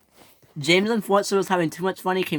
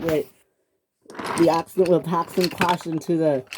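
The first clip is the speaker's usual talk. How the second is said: ordinary delivery, nothing marked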